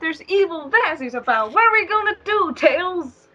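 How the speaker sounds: high, hammed-up cartoon alarm; panicked hero voice. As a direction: squeal it in mock panic. Mocking Sonic storyline, voicing Sonic.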